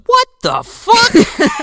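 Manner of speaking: high, loud, slow drawn-out what the fuck; hammed-up disbelief from the control room; mock outraged shout. Whoopi watching Santa, mock shouted outrage. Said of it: "she goes".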